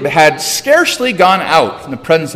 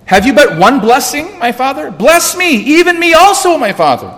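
very high, desperate begging; raised, weeping plea; 10 semitones higher than usual. A narrator's reading. Son begging his father for one blessing, anguished and high. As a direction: plead at a high pitch, dropping on the last words. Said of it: "lifted up his voice and wept".

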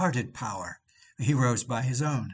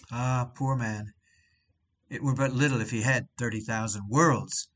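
ordinary talk

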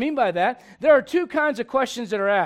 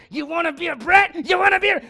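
sister's angry yell; 5 semitones higher than usual. Retold or imitated. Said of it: "and said".

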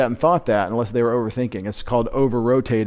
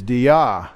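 dia, drawn out slowly with the accent on its last syllable; stress on second syllable; 4 dB louder than usual. Shown as a demonstration. Teacher showing students where the Greek accent goes, dia stressed on second syllable. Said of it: "accent is on the second syllable".

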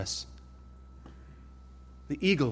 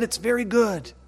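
a lightly voiced God, pleased and higher; God's pleased voice; 6 semitones higher than usual. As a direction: lift it as God admiring his work. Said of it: "And he said".

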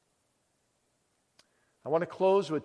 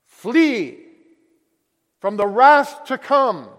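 loud, higher cry of warning; urgent warning; 8 semitones higher, 13 dB louder, much slower than usual. Acted. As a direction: shout the warning to flee. Evangelist's warning.

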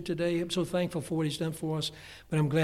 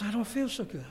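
a hint of a queasy wife, higher; mild mock sickly whine; lightly voiced wife; 6 semitones higher than usual. Preacher imitating his wife telling him she feels ill, lightly voiced. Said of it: "She goes".

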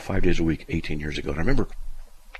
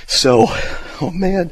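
normal talking voice

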